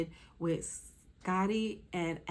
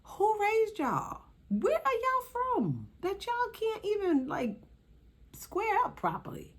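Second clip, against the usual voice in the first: mock scolding; 12 semitones higher than usual. Scolding fighters who can't fight.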